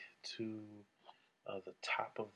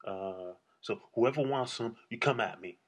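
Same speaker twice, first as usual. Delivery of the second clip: mock tough threat; 2 semitones higher, 7 dB louder than usual.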